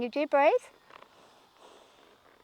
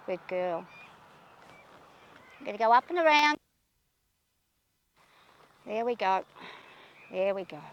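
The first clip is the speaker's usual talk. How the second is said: soft praise; 4 semitones lower, 4 dB quieter than usual. Real, not acted. Rider quietly praising her calm mare, low and tender. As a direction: reassure her softly.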